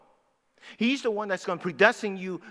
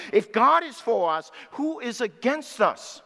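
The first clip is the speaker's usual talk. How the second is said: louder, slower punch on who is against us; building proclamation; 5 semitones higher, 4 dB louder, slower than usual. Acted. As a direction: proclaim it as Paul's triumph. Quoting Paul, building.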